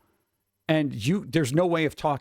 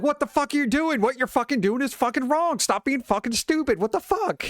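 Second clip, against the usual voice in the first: mock yelling, pitched much higher; yelly guide; 11 semitones higher than usual. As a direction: imitate a guide barking at a clueless newbie. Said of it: "we had a very yelly guide".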